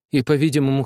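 ordinary delivery

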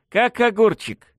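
slow, high, cocky boast; smirking. A narrator's reading. Revived victim boasting to his baffled killers, smirking. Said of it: "с ухмылкой ответил я".

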